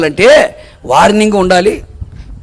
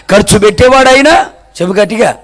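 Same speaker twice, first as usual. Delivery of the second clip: ordinary talk, nothing marked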